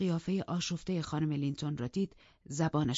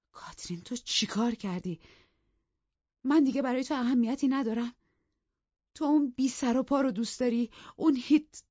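breathy start, then louder wounded reproach; plead, then accuse her; a hint of a jealous husband; hurt husband; 9 semitones higher, 5 dB louder than usual. Narrator voices Edgar wounded.

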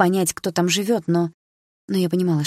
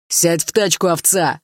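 louder rough command, тачку hit hard; angry yell; 5 dB louder, slower than usual. A narrator's reading. Bearded ex orders the girl into the car, angry yell. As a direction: bark the order at her. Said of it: "заорал он в ответ".

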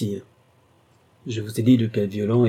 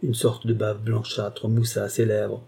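ordinary delivery

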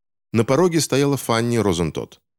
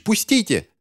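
very high, strained acted cry; yelling; 13 semitones higher than usual. A narrator's reading. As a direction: yelp frantically to be released. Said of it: "вопил Финч". (пронзительно высокий вопль)

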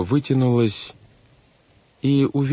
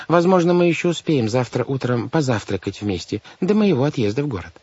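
higher-pitched fussy banker; fussy, brisk guardian; 7 semitones higher than usual. A narrator's reading. Banker sends orphans to their new home, fussy. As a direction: send children off as their guardian. Voices Mr Poe dismissing the orphans.

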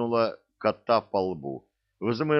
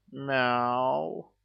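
high, mournful cat cry; sad meow; 4 semitones higher than usual. A narrator's reading. Chained cat repeating his cry at night, sad meow. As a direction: meow sorrowfully. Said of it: "грустно повторил он".

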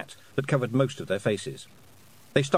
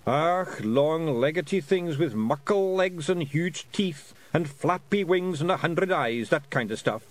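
excitable Scottish pixie, drawn-out words; Daft Woolly; 6 semitones higher than usual. Acted. Daft Woolly describing nightmare monsters, excitable Scots. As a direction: relish each creepy feature in a Scots voice.

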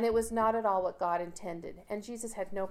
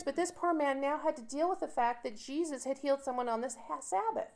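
higher-pitched mock sympathy; sarcastic; 4 semitones higher than usual. Bible teacher mocking the indignant synagogue leader, sarcastic. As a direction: lay the sarcasm on thick. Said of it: "Do you hear the sarcasm in my voice?".

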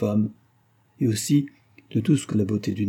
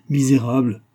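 louder, angry insult; furious; 3 semitones higher, 5 dB louder than usual. A narrator's reading. Husband forces wife to her knees, angry. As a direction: hurl the word with restrained fury. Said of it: "Sa colère était si forte".

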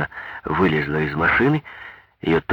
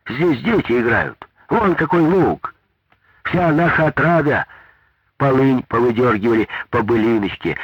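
indignant protest; 7 semitones higher than usual. A narrator's reading. Big woman protesting to Maria Ivanovna about the gravel. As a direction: protest hotly for the children's meadow.

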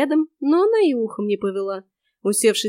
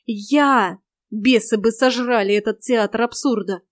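ordinary delivery, nothing marked

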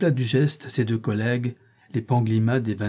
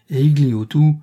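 usual speaking voice

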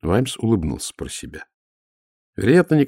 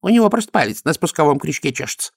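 higher-voiced priestly grumble; grumbled remark; 8 semitones higher, faster than usual. A narrator's reading. High priest remarks on the vengeful god, grumbling. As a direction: grumble it as a dismissive aside. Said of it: "буркнул чудакули".

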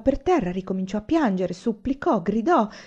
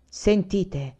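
firm voice. A narrator's reading. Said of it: "disse con voce ferma".

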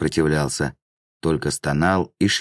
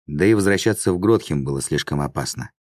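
ordinary delivery

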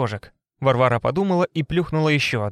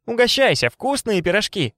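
sing-song; 7 semitones higher than usual. A narrator's reading. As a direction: sing it like a sweet hostess. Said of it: "пропела Варвара".

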